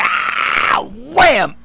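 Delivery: shouted impact noise in a retelling; acted yell. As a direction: act out a crash with the voice. Acting out his ring entrance.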